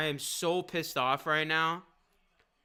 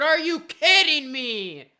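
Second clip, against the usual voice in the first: higher, louder, incredulous outburst; outraged disbelief; 4 semitones higher, 6 dB louder, much slower than usual. Real, not acted. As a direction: blurt it out in real outrage. Outburst at injury news.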